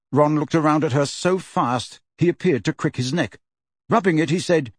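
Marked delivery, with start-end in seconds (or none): none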